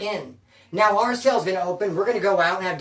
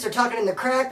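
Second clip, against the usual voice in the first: ordinary delivery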